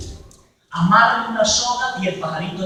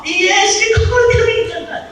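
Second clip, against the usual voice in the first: much higher mock confession; mock penitent; 14 semitones higher, 4 dB louder than usual. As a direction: squeak it like a careless penitent.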